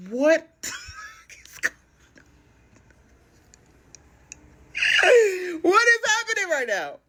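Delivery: high, loud laughing cry of disbelief; what is happening, laughing high and loud. Retold or imitated. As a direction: squeal it out in amazed laughter. Disbelief at his trolling.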